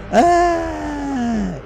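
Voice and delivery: high yelped ah; mocking yelp. Shown as a demonstration. Showing viewers the hook's ah, high mocking yelp. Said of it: "Emphasis on the".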